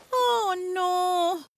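high, sustained cry of dismay; dismayed exclamation. A narrator's reading. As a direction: exclaim in distress without shouting. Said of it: "exclamó Annie".

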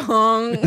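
loud honk; mock horn noise; imitated honk. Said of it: "You just go".

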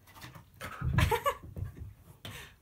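a long airy burst of laughter; breathy laugh. Real, not acted. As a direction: laugh with relief at the good dog. Dog obeys, she laughs.

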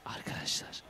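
breathy unvoiced whisper. Real, not acted. Streamer whispering to viewers in a horror game. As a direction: hush it for the mic. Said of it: "böyle konuşmam lazım".

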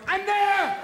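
high, loud triumphant shout; ski jumper's yell. Acted. Stoned Swiss ski jumper announcing arrival, high-pitched yell. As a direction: holler it from midair. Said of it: "like a Swiss ski jumper going".

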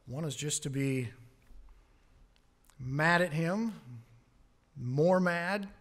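ordinary talk, nothing marked

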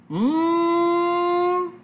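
long, high savouring "mmm"; yummy hum. Acted. Teacher savours the title for the class, yummy hum. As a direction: draw out a high, delighted hum.

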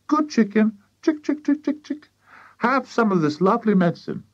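high-pitched chicken-calling voice; coaxing. A narrator's reading. George offers chicken medicine number three, high coaxing call. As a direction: coax the bird with high little clucks.

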